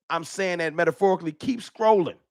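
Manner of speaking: ordinary delivery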